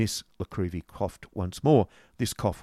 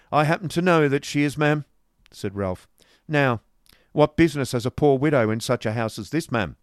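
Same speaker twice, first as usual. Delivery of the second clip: put-on louder, brusque old moneylender; stern businessman; 6 semitones higher, 7 dB louder than usual. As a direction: lay it down hard and brusque. Ralph voiced through dialogue.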